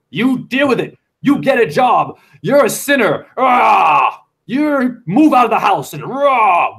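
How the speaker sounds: hammed-up scolding parent, ending in a roar; mock parental tirade. Mimics parents who never apologize.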